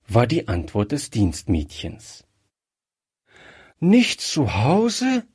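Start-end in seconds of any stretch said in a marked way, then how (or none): 3.8-5.2 high, slow, dismayed question; despairing cry. A narrator's reading. Gretchen learns the colonel is out, despairing. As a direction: echo it in dismay, drawn out and rising. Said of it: "rief Gretchen verzweifelt".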